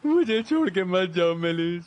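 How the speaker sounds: slow, drawn-out begging; desperate pleading. Acted. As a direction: plead with her to stay, stretching out the words. Man begs her not to leave.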